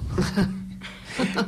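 light airy laugh; breathy laugh. Real, not acted. The writer laughs recalling his childhood ruse.

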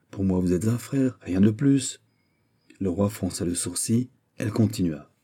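ordinary delivery

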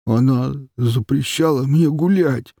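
high whimpering complaint; whining. Acted. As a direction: whine it like a hurt child. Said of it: "вдруг захныкал мужчина".

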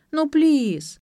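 high whiny pleading; whining. A narrator's reading. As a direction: beg like a spoiled girl. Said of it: "начала упрашивать".

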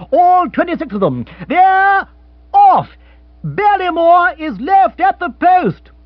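high, loud racing call, building to a shout; excited commentator shout. Acted. The radio commentator roaring as the race starts. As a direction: shout the off like a hawker. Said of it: "yelled the radio".

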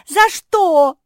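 high, loud panicked cry. A narrator's reading. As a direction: shriek in panic. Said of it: "запаниковала мопсишка".

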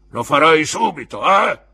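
molto più alto e forte; eco gridata. A narrator's reading. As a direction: urla l'eco del padrone. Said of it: "echeggiò l'uomo con la gamba di legno". (il custode ripete gridando le parole di Creakle)

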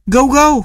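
high, short yips; dog barking. A narrator's reading. Dog yips at the door, high and short. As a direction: yip like a small dog.

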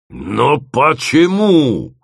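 long, rising wail of despair; anguished. A narrator's reading. Groom laments to his bride, anguished wail. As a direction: stretch it out, grieving theatrically. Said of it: "убивался Дима".

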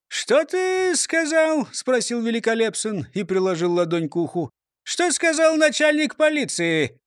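high jolly mayor voice; beaming, puzzled mayor; cheerful mayor. A narrator's reading. Mayor voice, hand to ear.

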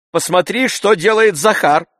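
high, loud, aggrieved complaint; complaining. A narrator's reading. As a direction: appeal indignantly to the maid about the other servant. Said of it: "пожаловался он ей".

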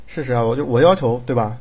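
normal talking voice

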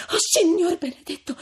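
high, loud shaken female voice; tremulous high outcry; trembling exclamation. A narrator's reading. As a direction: gasp it out, overwhelmed. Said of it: "replicò ancor più tremante Lucia".

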